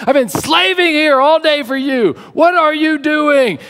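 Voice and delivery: high-pitched, loud scolding of a husband in the chair; angry wife. Acted. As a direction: rant at him, dragging out the accusing questions. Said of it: "she's angry at me".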